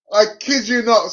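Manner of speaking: ordinary delivery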